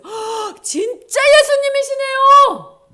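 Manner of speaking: gasp, then loud high cry; startled amazement. Acted. Mary startled to recognize Jesus, astonished shout. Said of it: "깜짝 놀라서".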